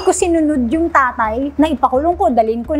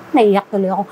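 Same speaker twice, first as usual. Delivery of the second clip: normal talking voice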